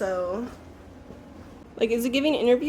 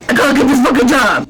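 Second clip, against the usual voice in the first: loud laughing exclamation; laughing shout; 13 dB louder, faster than usual. Real, not acted. Anxious outburst.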